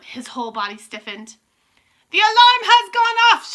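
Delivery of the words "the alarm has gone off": very high, loud, drawn-out screech; piercing witch cry, slow and ringing; triumphant shriek. A narrator's reading. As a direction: shriek it out in glee. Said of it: "shrieked the Grand High Witch".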